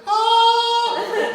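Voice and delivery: very high sung howl of pain. Retold or imitated. Patient howling as the squeeze gets harder, very high howl. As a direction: shoot the yell way up high.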